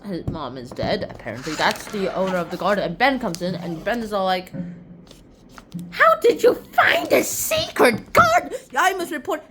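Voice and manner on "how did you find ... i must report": very high, loud squawk, drawn out; hammed-up shock. Gardener catching children in secret garden, shocked shrieking. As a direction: shriek in mock horror.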